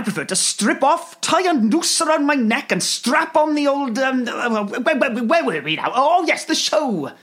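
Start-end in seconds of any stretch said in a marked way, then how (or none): none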